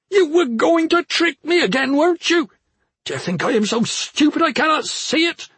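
high, loud, outraged ranting; furious accusation. A narrator's reading. Brother accusing his sister of tricking him, raging. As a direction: keep screaming at her in outrage.

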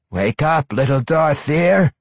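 looming monster voice, high and pushed; drider voice. A narrator's reading. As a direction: taunt her like a gleeful monster. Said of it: "Eurynine said when he loomed over Dahlia".

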